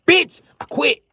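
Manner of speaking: very high, loud yell; hammed-up high outburst; shouted quitting. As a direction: scream it in mock fury. Acts out quitting as father.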